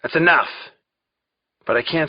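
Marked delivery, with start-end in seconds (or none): 0.0-0.5 louder, higher order to stop; forceful command. A narrator's reading. A voice stops the beating, insistent raised command. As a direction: put your foot down, raised voice.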